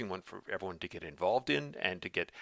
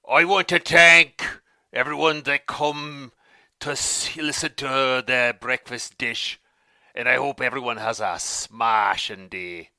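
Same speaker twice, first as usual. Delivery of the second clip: booming grand Sphinx voice; 2 semitones higher, 9 dB louder than usual. Acted. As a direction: boom it out like a mythic Sphinx. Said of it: "Maybe your Sphinx voice".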